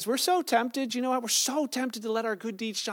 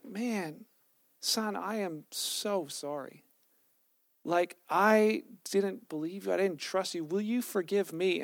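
soft, slow, remorseful dad; sincere apology; 2 semitones lower, 4 dB quieter, slower than usual. Retold or imitated. Dad apologizes to his tearful son for doubting him, soft remorse. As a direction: ask forgiveness gently and quietly.

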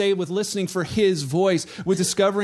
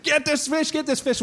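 excited higher-pitched urging; urgent retold excitement; 6 semitones higher than usual. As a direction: act out an angler urging help with a catch. Friend reeling in big catch.

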